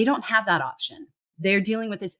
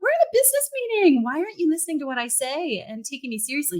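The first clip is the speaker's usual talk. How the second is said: high, fast frustrated protest; exasperated retort; 9 semitones higher, faster than usual. Retold or imitated. Speaker retorting to colleagues in a meeting, fast exasperated. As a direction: snap back in disbelief at being patronized. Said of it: "It's like".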